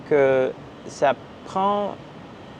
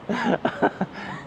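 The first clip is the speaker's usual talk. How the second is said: breathy giggle; 8 semitones higher, 5 dB quieter than usual. Real, not acted. Still laughing at her face.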